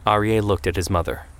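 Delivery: plain reading voice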